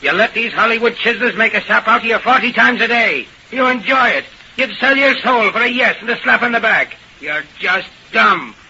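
high-pitched, heated tirade; acted outburst in a high, insistent voice; blurted insults. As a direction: blurt it out high and scornful, drawing out the final jab. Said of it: "blurted out".

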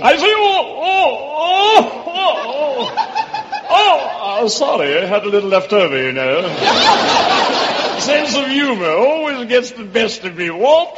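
high, giggling English toff; hammed-up English accent breaking into titters. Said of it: "that's a wonderful English accent".

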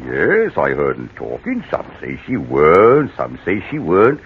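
lightly voiced West Country yokel; a friendly menace behind the country charm; country gardener chatting to the old lady; rustic gardener.

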